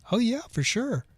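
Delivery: high, eager agreement; eager yes. Retold or imitated. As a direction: jump at the invitation. Eager yes to Salma's invite.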